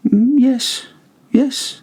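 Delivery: very high, coy sing-song yes; sly Montresor. A narrator's reading. Montresor slyly assuring Fortunato, very high and coy.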